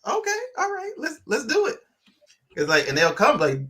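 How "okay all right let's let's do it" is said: much higher, chirpy giving in; mock eager mom. Mom retelling giving in to the kids, high and chirpy. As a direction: squeal a cheerful yes. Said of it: "I was like".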